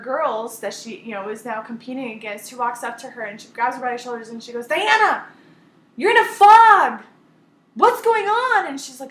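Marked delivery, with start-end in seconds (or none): 4.6-8.7 high, loud urgent plea to a teammate; a girl shouting to snap a friend out of a daze; urgent call. Retold or imitated. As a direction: shake her out of it with your voice. Teammate grabs her by shoulders.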